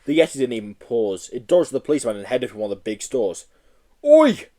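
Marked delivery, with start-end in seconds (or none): 4.0-4.5 loud high shout. Acted. Policeman shouting after the Yeti, loud shout. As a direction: yell it like a copper. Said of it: "shouted the policeman".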